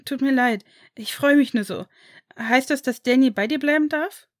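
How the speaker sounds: put-on high, loud excited boy. A narrator's reading. Harry excitedly asks whether Daniel may stay. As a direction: burst out with an eager question. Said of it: "fragte Harry nun aufgeregt".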